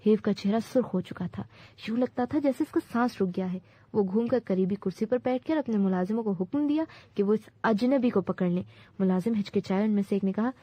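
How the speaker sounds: plain reading voice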